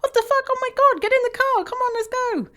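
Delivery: high, startled outburst at the hail; alarmed outburst. Retold or imitated. Caught in a hailstorm, urging the dog into the car, high and alarmed. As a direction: yelp it as the hail hits. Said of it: "I was like".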